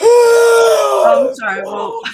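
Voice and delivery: high, loud, drawn-out sorry through laughter; laughing apology. Real, not acted. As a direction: wail the sorry while cracking up. Partner apologizing while laughing.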